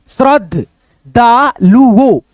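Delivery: श्रद्धालुओ very slow, stretched; slow demo. Shown as a demonstration. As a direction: sound out श्रद्धालुओ slowly. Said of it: "द के साथ में combined है". (श्रद्धालुओ को बहुत धीरे)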